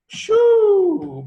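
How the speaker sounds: high, loud amazed exclamation; sharp high exclamation of disbelief; whistling shoo. Real, not acted. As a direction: let out a big astonished shoo. Exclaiming at the lawyers' fees.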